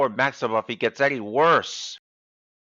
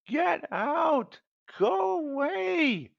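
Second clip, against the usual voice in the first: high, slow hammed-up yell; mock yell; much slower than usual. Sick man yells at an ant in his bed, hammed-up high. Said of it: "he yelled".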